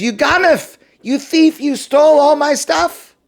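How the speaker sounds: high, loud, furious yelling at the thief; angry accusation. Acted. Merchant catching the thief with his goods, shouting angrily. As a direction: yell it out like a robbed man who finally caught him.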